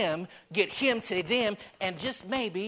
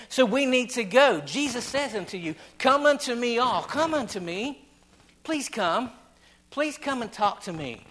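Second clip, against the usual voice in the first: louder, rising preacher's push; fervent pulpit preaching; 3 semitones higher, 4 dB louder than usual. Acted. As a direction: drive the point home from the pulpit. Preaching the invitation with fervour.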